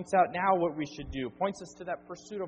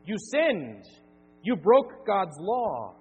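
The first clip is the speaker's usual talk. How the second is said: louder, slow and heavy verdict; stern declaration; 3 semitones higher, 8 dB louder, much slower than usual. Acted. Preacher voicing God's law to sinners, stern loud verdict. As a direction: pronounce the guilty sentence.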